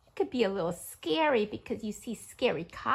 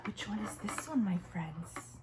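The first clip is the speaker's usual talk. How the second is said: whisper; 5 semitones lower, 6 dB quieter than usual. Acted. Whispered question to kids.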